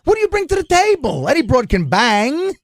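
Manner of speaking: imitating Dice yelling life advice; Dice impression. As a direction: rant about the chicken, loud and high. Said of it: "I said".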